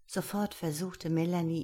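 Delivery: usual speaking voice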